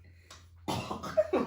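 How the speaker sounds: high, breathless shriek of laughter. Real, not acted. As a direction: burst out in a shrill laugh. Reacts to disgusting food.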